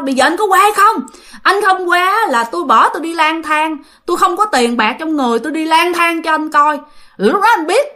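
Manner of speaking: retold threat, high and insistent; defiant ultimatum. Girlfriend threatens to wander off penniless, giving an ultimatum. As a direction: lay down a high-pitched final warning. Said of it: "nó mới chốt một câu".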